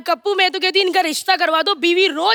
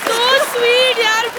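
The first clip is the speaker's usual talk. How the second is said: drawn-out gushing; gushing slow; 5 semitones higher, much slower than usual. Acted. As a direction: stretch it out, gushing. Gushing over shy player.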